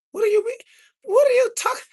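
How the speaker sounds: high, squeaky disbelief; stunned what-are-you-on; incredulous. Real, not acted. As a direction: act baffled by the question. Incredulous at chat comment.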